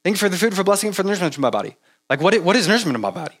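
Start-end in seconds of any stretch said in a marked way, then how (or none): 0.0-1.8 fast mumbled mealtime prayer; imitating a fast rote grace; rushed rote grace. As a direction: rattle it off without thinking. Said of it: "we would just say it so fast".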